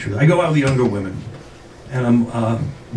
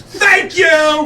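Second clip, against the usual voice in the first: high-pitched, exultant shout; triumphant cry; 11 semitones higher than usual. Retold or imitated. Customer to the manager who accepts his card, triumphant. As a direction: crow it in victory.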